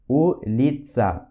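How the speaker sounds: улица slowly, split as U-LI-TSA; syllable by syllable. Shown as a demonstration. Teacher modelling the Russian word for street, улица syllable by syllable. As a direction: draw out each syllable of улица. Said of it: "o U de улица".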